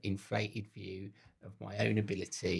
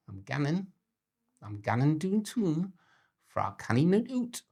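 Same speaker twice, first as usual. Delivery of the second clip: a cheery Geordie impression that slides toward Scottish; Geordie accent; 5 semitones higher than usual. Shown as a demonstration. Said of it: "That was very good. Went a bit Scottish".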